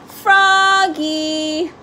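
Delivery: father calls his son from across the house; calling out. A narrator's reading. Father calling Froggy to get going, calling out. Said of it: "called his father".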